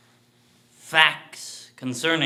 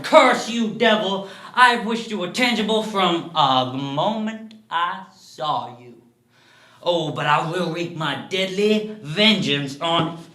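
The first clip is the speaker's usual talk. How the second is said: loud, raised-pitch cursing; roaring; 5 semitones higher, 7 dB louder than usual. A narrator's reading. Man springs at his conscience swearing vengeance, roaring. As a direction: roar threats at the creature, easing slightly.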